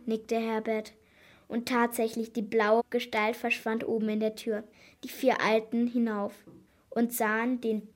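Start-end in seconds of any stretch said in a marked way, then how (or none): none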